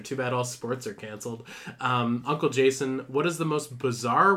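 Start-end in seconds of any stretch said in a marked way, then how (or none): none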